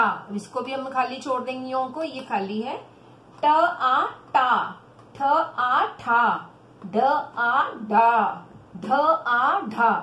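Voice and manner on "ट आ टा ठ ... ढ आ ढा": very slow, drawn-out syllables, firmly projected; pronunciation drill. Shown as a demonstration. Teacher drilling children on the barakhadi, slow pronunciation demo. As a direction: sound out each letter and vowel, then blend them. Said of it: "पहले उच्चारण करो".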